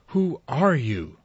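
high, loud and slow startled cry; frightened cry. A narrator's reading. Sarah cries out to the ghost at the board, frightened. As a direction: call out to the unseen spirit in fear. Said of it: "Sarah cried out".

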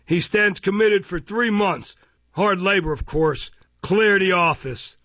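loud pronouncement ending in an order; peremptory magistrate. Acted. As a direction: hand down sentence brusquely. Magistrate sentences and dismisses.

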